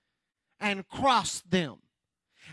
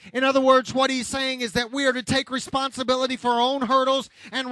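raised, driving preacher's voice; forceful preaching; 5 semitones higher, 5 dB louder than usual. Real, not acted. Preacher rebuking congregation for old hang-ups, forceful preaching.

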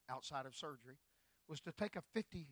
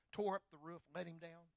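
usual speaking voice

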